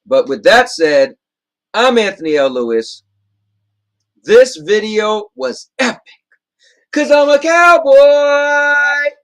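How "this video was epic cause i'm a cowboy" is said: slow, high, loud and drawn out; hyped outro. Acted. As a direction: stretch it out like a big show intro. Signature outro catchphrase.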